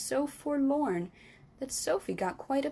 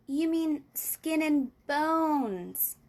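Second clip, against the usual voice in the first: put-on slow, higher girl's voice; girl correcting the giant; Sophie voice; 5 semitones higher, 3 dB louder, much slower than usual. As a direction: gently correct him as a little girl.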